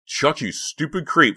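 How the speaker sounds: high, loud, slow furious shout; furious shout at her brother; angry shout. A narrator's reading. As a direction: blast him with fury. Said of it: "she shouted".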